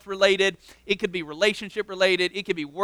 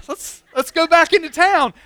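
mock pleading; 9 semitones higher, 4 dB louder than usual. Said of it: "he's like".

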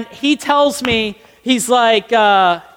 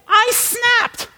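dramatic high squeal; 11 semitones higher than usual. Retold or imitated. Roommate story reaching breaking point, comic high-pitched delivery. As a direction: pitch leaping far up.

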